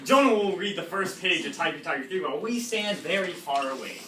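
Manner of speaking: ordinary delivery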